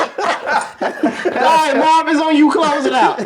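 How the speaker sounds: high, laughing while passing the mic. Real, not acted. Host laughing as he hands the topic to Marv. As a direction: still cracking up as he hands over.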